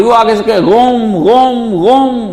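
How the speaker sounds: put-on a light Persian accent calling out غوم; Iranian driver's accent. A Tehran taxi man answers the preacher, saying غوم. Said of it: "آقا کو وہ آغا پڑھتے ہیں".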